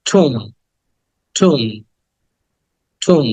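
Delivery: "ঠুম" with a long oo and silent b; correct pronunciation. Shown as a demonstration. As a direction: model "ঠুম" as an aspirated toom. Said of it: "এটাকে উচ্চারণ করবো".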